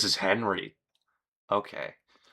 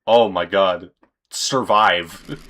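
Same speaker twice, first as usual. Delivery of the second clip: ordinary talk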